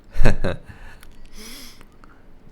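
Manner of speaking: high, loud real laugh; burst of laughter. Real, not acted. Streamer laughs at game joke.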